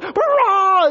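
high, loud hammed-up yell; shocked scream. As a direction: scream as if someone just vanished. Acting out shock at disappearance.

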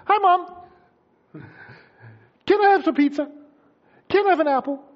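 put-on high, sweet-talking kid voice; cheery child. Child coming into the kitchen to Mom, cheery. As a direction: imitate a kid chirpily asking for treats. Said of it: "They're all cheery".

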